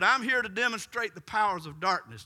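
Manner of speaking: high, loud declaration; defiant witch. Retold or imitated. Witch announcing herself to the pastor, loud and defiant. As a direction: proclaim it as a challenge.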